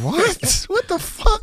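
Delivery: high squeaky disbelief; high-pitched what the fuck; shocked. Retold or imitated. Shocked reaction in story.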